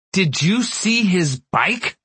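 father yelling at his wife about the ruined bike, high and slow. Acted. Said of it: "my father yelled".

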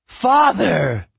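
loud, high cry to her father. A narrator's reading. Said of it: "Her voice was higher and reedier".

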